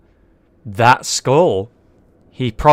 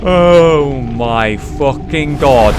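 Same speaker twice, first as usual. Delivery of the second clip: gasping in awe; 2 semitones higher, much slower than usual. Real, not acted. Boss reveal, gasping in awe.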